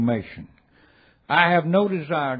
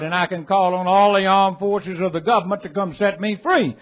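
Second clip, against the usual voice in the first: high, loud preaching voice that rings out; full-force preaching; 7 semitones higher, 5 dB louder than usual. Acted. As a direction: preach it hard to the whole room.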